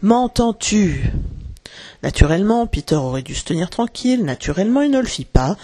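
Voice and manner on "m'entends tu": very slow, higher, drawn-out question; calling out. A narrator's reading. Crochet calling to the spirit of the lagoon, drawn-out cry. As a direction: summon someone unseen with long vowels. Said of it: "cria-t-il".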